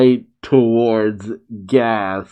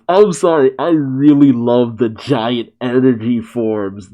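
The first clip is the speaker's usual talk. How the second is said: usual speaking voice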